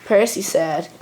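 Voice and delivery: ordinary delivery, nothing marked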